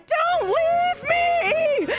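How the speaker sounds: very high, drawn-out mock wail; desperate plea. Trapped sister grabbing at her, desperate drawn-out wail. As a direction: wail it slowly, begging in terror. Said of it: "she's just like".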